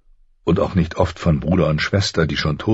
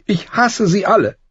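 put-on much higher, louder girl's outburst of hate; tearful hatred; 4 dB louder than usual. A narrator's reading. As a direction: daughter spitting out her hatred in tears. (hasserfüllt, den Tränen nah)

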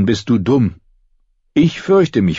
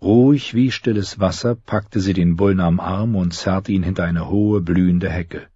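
usual speaking voice

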